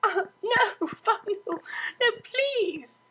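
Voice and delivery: laughing pleas. Acted. Cellist begging her tickling friend to stop, laughing. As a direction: plead while being tickled. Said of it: "hysterical laughter".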